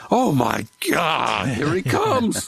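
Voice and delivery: drawn-out pretend terror as he approaches; mock scared viewer. Playing a nervous moviegoer, slow and mock frightened.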